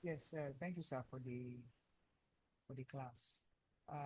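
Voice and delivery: very faint, distant voice; barely audible. Real, not acted. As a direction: speak faintly, as if far from the mic. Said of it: "your voice is very faint".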